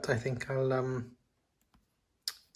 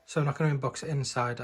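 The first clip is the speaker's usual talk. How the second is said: ordinary delivery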